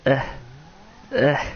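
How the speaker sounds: normal talking voice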